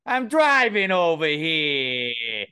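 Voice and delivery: put-on loud, slow, stretched New York accent; Northeastern US accent. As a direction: holler it in exaggerated Boston-Philly-New York. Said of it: "Boston and Philly and New York combined".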